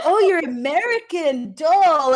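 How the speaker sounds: high, slow, loud gushing; hammed-up delighted recognition; imitated fawning. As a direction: coo admiringly at a foreigner, drawn out. Imitates locals admiring the American.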